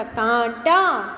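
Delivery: कांटा drawn out with a nasal aa; slow nasal kaanta. Shown as a demonstration. Teacher revealing the nasal word slowly. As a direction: say कांटा slowly through the nose. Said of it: "ट, ट पे आ की मात्रा".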